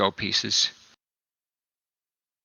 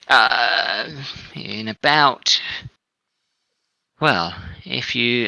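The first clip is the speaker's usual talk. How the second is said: slow, a touch higher and louder, a lightly voiced formal halfling official; formal quartermaster; 4 semitones higher, 5 dB louder, slower than usual. Halfling quartermaster telling the gentlemen where their ship docks, formally. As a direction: announce the ship's arrival with stiff courtesy.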